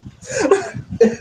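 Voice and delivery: unvoiced high cackle; breathy high laugh. Real, not acted. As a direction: let out a breathy squeal of laughter. GM laughing at reaction.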